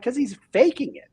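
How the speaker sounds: high, punchy dismissal; knowing retort. Retold or imitated. Owner telling agent the male hire is bluffing. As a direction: call out the bluff.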